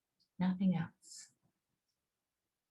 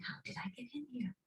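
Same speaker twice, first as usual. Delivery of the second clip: whispered thought; 4 semitones higher, 11 dB quieter, faster than usual. Pretend. Imagined inner thought in dark room.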